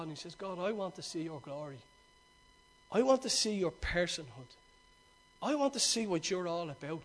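acted hushed, low, reverent dialogue; soft, low voice, as if close in with God; hushed plea. Preacher voices Moses quietly asking to see God's glory, hushed.

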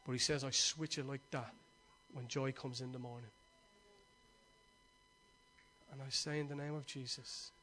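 soft, low, intimate prayer voice; hushed prayer. Acted. Pastor praying quietly over the church, soft low prayer. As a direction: pray it softly, almost to yourself.

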